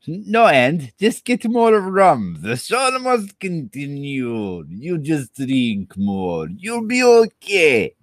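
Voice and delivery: high, slow sing-song coaxing; odd coaxing voice. Pretend. Streamer urging himself on with rum, high coaxing voice. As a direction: coax himself to keep drinking, high and drawn out.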